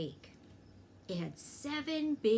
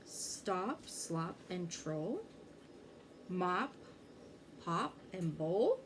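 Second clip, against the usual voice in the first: muddled words; 2 semitones lower, 5 dB quieter, slower than usual. A narrator's reading. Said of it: "couldn't get Mister Martin's words right".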